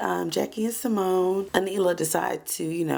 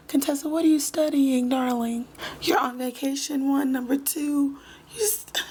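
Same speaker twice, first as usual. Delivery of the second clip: mock scolding; 7 semitones higher than usual. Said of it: "mocking husband scolding wife".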